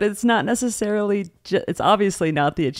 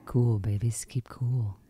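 whispering; 6 semitones lower, 8 dB quieter, slower than usual. Pretend. Joining the mellow late-night bit.

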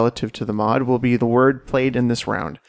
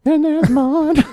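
dramatic echo; 5 dB louder, slower than usual. Acted. Echoing in higher dramatic voice.

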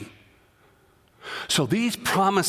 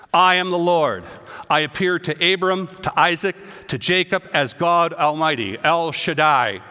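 scripture reading; 5 dB louder than usual. Acted. Preacher reading God's words to the congregation, emphatic. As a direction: proclaim the Lord's words.

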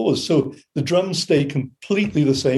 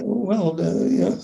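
higher-pitched, hesitant mumbled answer; imitated uncertainty; 6 semitones higher, much slower than usual. Band asked if they know what jive is, imitated uncertainty. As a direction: act out someone caught not knowing.

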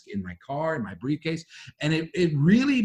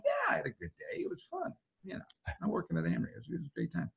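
much higher, softer sing-song reassurance; forced cheerfulness; 4 semitones higher, 12 dB quieter, faster than usual. Retold or imitated. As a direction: chirp it out, overly upbeat. Answering wife, masking mood.

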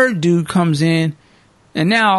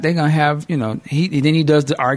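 ordinary talk

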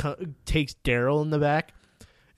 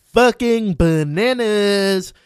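excited; 6 semitones higher, 8 dB louder, much slower than usual. Real, not acted. Excited recap of episode.

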